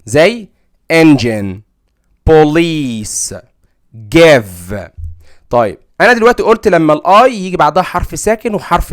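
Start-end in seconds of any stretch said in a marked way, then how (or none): 0.9-4.7 انجن, بوليس, جيف said slowly as exceptions; teacher modelling exceptions; exceptions demo. Shown as a demonstration. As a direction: model the odd words carefully. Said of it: "كلمات سمعيه خارجه عن القاعده".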